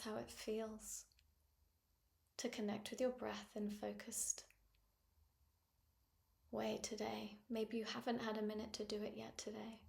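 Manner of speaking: calm phrases with long pauses; guided meditation. Acted. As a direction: invite them gently to notice the breath. Leads a breathing meditation.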